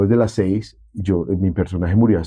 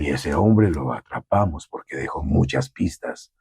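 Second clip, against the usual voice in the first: lower, softer, slow aged voice; old man voice; 6 semitones lower, 4 dB quieter than usual. Shown as a demonstration. Voice actor demos the aged policeman's interview, low and slow. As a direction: let the voice sag into an old retired cop. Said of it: "entonces ya sí salía hablando así".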